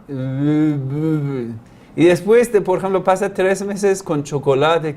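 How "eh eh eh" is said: put-on baby babbling.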